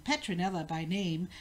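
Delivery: ordinary delivery, nothing marked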